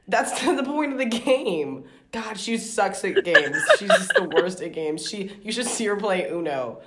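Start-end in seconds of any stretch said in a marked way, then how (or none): 0.1-5.0 laughing. Real, not acted. Laughing at friend's refusal.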